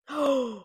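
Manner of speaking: high, sudden gasp of shock; sharp gasp. Real, not acted. Reader reacting to a shocking manga panel, shocked gasp.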